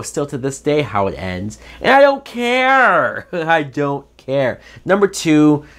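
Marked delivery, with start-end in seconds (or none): 1.8-3.3 much higher, slow, exasperated; emphatic dismissal. Real, not acted. 3.3-4.6 chuckling, slow and dismissive; laughing repeat. Real, not acted.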